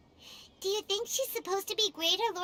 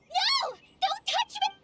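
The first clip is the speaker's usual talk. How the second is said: high, loud cry of refusal; alarmed protest; 11 semitones higher, 4 dB louder, slower than usual. Acted. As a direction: cry out to fend someone off. Protesting at being touched.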